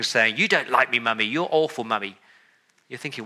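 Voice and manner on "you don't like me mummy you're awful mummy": put-on child's tantrum. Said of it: "they are screaming".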